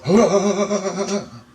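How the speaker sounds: high, quavering "ah" shuddered out; long wobbly high-pitched cry; shaking cry. Retold or imitated. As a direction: wail while your whole body shakes. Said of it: "My voice is going".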